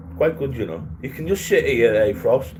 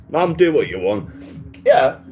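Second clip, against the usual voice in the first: ordinary talk, nothing marked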